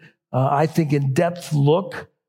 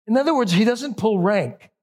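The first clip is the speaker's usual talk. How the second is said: ordinary talk, nothing marked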